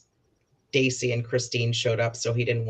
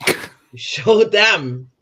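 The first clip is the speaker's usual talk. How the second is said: slow, louder, higher mock praise; sarcastic drawn out; 6 semitones higher, 8 dB louder, much slower than usual. Real, not acted. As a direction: stretch it out to ridicule him. Sarcastic jab at Donald's clip.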